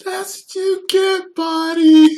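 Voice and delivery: very high, loud, slow gloating shout; Ashley Miller voice. Retold or imitated. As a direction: gloat at the crashed driver like a Canadian skater girl. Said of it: "Ashley Miller talks like".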